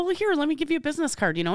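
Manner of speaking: high and fast, eager offer. Retold or imitated. Handing the seller a card, eager. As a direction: rush to hand over the card. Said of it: "And I go".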